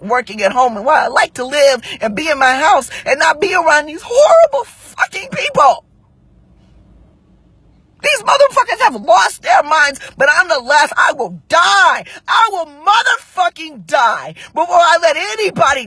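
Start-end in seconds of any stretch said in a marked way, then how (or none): 4.0-15.9 high, impassioned shouting; worked-up, outraged yelling; real furious outburst. Real, not acted. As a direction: rant at the top of your feelings, voice pitched up. Venting, losing composure.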